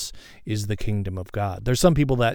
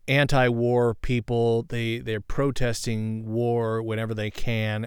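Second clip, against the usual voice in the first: usual speaking voice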